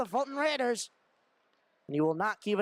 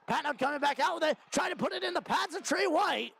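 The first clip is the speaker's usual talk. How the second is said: higher, quickening hockey call; excited play-by-play; 6 semitones higher than usual. Real, not acted. Hockey announcer calling a push at the goalie's pads, excitedly. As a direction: lift the pitch as the attack builds. Scoring attempt building in live call.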